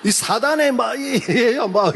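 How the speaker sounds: high-pitched retold tirade; mock cursing. Deaconess cursing her husband as Satan's, mock angry tirade. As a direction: imitate a wife sputtering half-finished insults, high. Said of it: "이러면서 막 욕을 하는 거예요".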